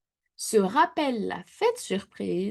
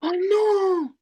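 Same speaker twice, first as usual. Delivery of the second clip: ordinary delivery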